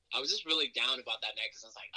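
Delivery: normal talking voice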